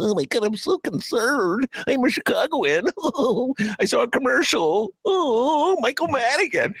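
high, hammed-up panicky TV viewer; mocking a Chicagoan fooled by TV ads, high and hammed-up. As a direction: squeal like a gullible viewer. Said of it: "an imitation of a Chicagoan watching".

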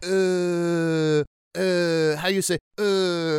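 high, halting mock French speaker; fake French accent. Mock French accent, tourist faking the language. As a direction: fumble like a foreigner with no words. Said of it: "all you do is go".

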